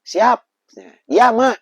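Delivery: put-on high, loud boyish yes; eager child reply. Little boy answering his mother's call, eager. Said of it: "saya disuruh ambil".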